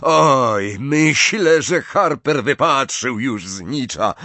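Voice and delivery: match commentator calling out; megaphone call. A narrator's reading. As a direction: project it like a stadium announcer. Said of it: "zawołał przez megafon Zachariasz Smith".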